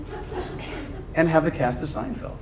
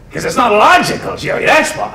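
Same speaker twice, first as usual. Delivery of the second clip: louder, higher exasperated retort; hammed-up sitcom bickering; Spock as sitcom character; 7 semitones higher, 6 dB louder than usual. Officer arguing logic with his friend, loud exasperation. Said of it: "Spock is sitting there going".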